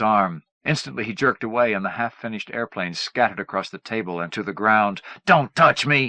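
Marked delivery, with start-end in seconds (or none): 5.2-6.1 louder, sharp warning; sharp refusal. A narrator's reading. Son pulling away from his mother, sharp and louder. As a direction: snap him back off. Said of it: "he told her".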